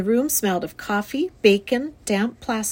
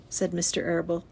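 plain reading voice

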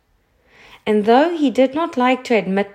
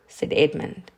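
plain reading voice

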